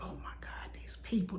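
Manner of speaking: breathy, near-silent retold sob of despair; broken down crying. Retelling the weekend of fear, a soft tearful whisper. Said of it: "I just broke down crying".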